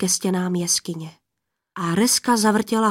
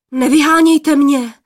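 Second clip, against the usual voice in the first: frightened plea; 5 semitones higher, 8 dB louder than usual. A narrator's reading. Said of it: "lekl se poplašený mládenec".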